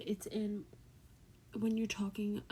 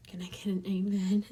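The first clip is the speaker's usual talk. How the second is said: louder, rousing call for agreement; calling for an amen; 7 dB louder than usual. Real, not acted. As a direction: call it out to the congregation. Calls out for an amen.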